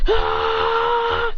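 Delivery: sustained high battle scream; high attack scream. Acted. Clones scattered around John, high attack scream. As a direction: let out a long, high war cry.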